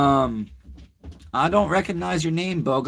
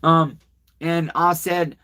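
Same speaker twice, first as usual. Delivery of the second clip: ordinary delivery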